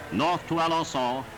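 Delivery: ordinary talk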